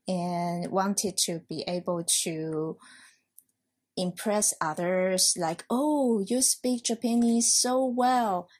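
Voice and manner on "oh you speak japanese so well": higher-pitched, lightly imitated praise; impressed admirer. Admirer complimenting her on her Japanese, lightly voiced impressed praise. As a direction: gush a little, as an imagined listener.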